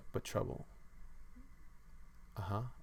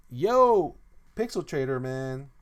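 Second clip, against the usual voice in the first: loud greeting; 4 semitones higher, 12 dB louder, slower than usual. Real, not acted.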